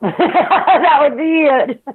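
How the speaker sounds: slow words broken by laughter; laughing. Real, not acted. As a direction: laugh it out, slowly.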